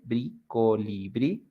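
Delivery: "brí" alone, then slow "colibrí" rising on brí; strong final sound. Shown as a demonstration. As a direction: isolate "brí", then spell out co-li-BRÍ. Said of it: "the strong sound, it's at the end".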